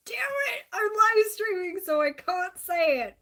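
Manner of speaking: high, loud yelp of frustration; frustrated outburst. Real, not acted. To her viewers, catching a swear mid-stream, frustrated yelp. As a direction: blurt it out, then stop yourself.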